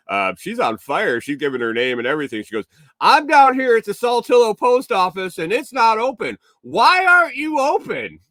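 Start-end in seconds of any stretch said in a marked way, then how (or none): 3.0-8.2 put-on high, loud scolding old lady; indignant old lady. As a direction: read him the riot act in a shrill granny voice. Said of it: "reading this dude the riot act".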